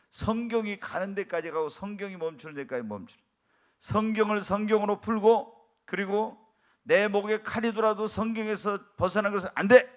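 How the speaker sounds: ordinary talk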